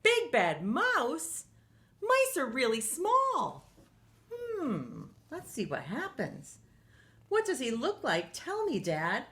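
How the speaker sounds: put-on high, curious child voice; little Gruffalo. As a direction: squeak it up like a small curious monster. Gruffalo's child questions her dad.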